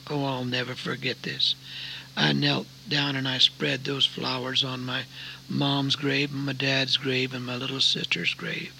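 low, soft graveside storytelling; solemn testimony. Real, not acted.

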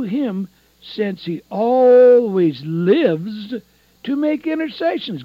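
loud, high-pitched scripture proclamation; fervent preaching. Real, not acted. Preacher proclaiming Hebrews to listeners, fervent preaching. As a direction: preach the verse out, stretching the big words.

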